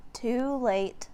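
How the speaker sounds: ordinary delivery, nothing marked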